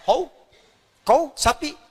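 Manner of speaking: normal talking voice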